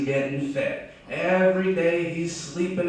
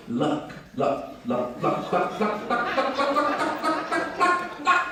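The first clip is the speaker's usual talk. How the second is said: "luck" repeated as clucks, climbing to a high squawk; clucking chicken; 13 semitones higher, slower than usual. Acted. Storyteller shows what chickens say about lucky eagles, clucking. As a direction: turn the word into chicken clucks, rising ever higher. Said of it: "If you listen real closely to a chicken".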